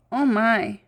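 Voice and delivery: normal talking voice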